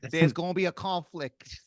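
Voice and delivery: hammed-up Australian accent. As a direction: call out a warning in mock Australian. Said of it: "was that an Australian accent".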